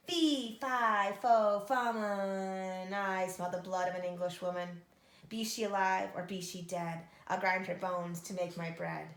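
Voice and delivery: loud, menacing giant chant; booming giant. Acted. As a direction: boom it out like a hungry giant.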